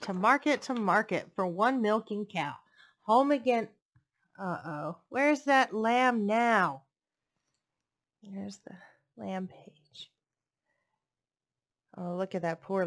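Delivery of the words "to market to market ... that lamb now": put-on lilting read-aloud voice for little kids; storybook reading. Reading a picture book to young children, lilting storytelling. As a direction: read it like bedtime story time.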